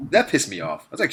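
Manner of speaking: usual speaking voice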